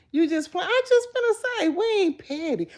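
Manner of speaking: high, loud teasing comeback; sassy high retort. Real, not acted. Answering a teasing viewer, high and sassy.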